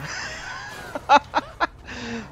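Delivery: high-pitched burst of laughing; high laughter. Real, not acted. Player laughing at a crash, high laughter.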